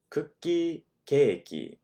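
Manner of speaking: cookie then keiki, said as two contrasting words; cookie versus keiki. Shown as a demonstration. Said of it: "If you want cake, you say keiki".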